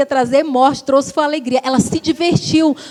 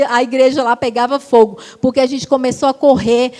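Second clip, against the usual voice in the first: ordinary delivery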